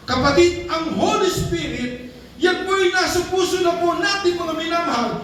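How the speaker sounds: high, fervent preaching; preaching at full force. Acted. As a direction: lift the congregation with fervor. Preaching at rising pitch.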